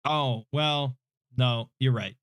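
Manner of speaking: ordinary delivery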